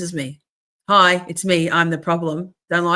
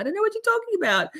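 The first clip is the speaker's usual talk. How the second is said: high, fast, baffled squeak; high-pitched confusion; much faster than usual. Retold or imitated. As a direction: squeak it out, totally lost. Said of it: "I can't".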